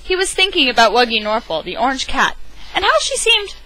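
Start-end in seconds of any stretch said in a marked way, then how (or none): 2.7-3.6 much higher, slightly louder narration; high wondering read; rising wonder. A narrator's reading. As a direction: lift it up in amazement.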